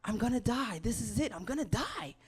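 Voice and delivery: panicked, high, loud wail of doom; frantic yelling. Retold or imitated. Panicking to her dad about cancer, frantic loud yelling. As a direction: yell it like you think you're dying. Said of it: "frantically yelling".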